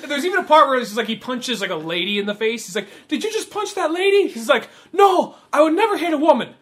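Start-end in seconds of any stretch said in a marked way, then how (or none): 3.1-4.3 high, incredulous question; mock appalled. Acted accusation from film. 4.9-6.5 high, loud defensive retort; mock indignant denial. Acted defensive retort from film.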